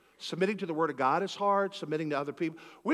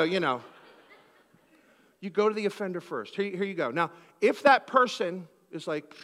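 ordinary delivery

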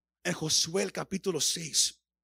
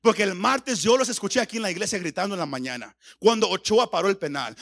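normal talking voice

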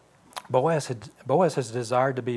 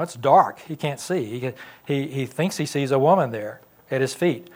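usual speaking voice

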